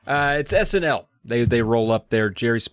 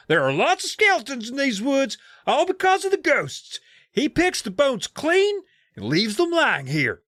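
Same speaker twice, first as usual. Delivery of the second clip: much higher eerie telling; spooky storyteller kid. Acted. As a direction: spin it like a campfire ghost story. Sam's spooky ghost tale.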